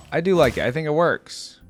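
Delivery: ordinary talk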